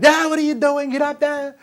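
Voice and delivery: put-on party guests hollering at a naked four-year-old; party guests yelling. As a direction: holler at the naked kid like a rowdy crowd. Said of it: "jump up and yell".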